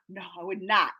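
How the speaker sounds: quietly backing off the mock protest; low, soft, falling refusal; subdued denial. Calms down after the shouted denial.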